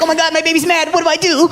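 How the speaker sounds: high, frantic whiny voice; hammed-up panic; panicked. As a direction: melt down like a scared kid. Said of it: "I would honestly be like".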